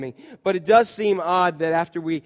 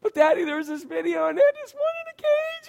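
put-on much higher crying little boy voice; crying child. Pastor imitates his son crying about a caged bunny, much higher. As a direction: imitate a five-year-old breaking into tears. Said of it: "and he's just lost it".

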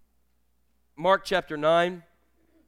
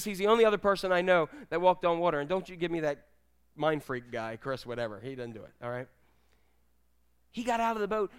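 normal talking voice

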